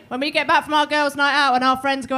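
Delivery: ordinary talk, nothing marked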